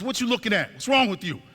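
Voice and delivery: high-pitched mock berating; retold angry challenge. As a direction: berate them, imitating a hostile sergeant. Acted sergeant berating the girl.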